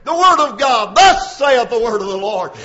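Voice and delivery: loud, high preaching shout; full-force preaching. Acted. Preacher proclaiming the word of God, shouting. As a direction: proclaim it like thunder from the pulpit.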